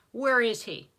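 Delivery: loud, forceful demand; angry demand. A narrator's reading. Enraged son questioning the servant, angry demand. Blaine angrily demands of Edward.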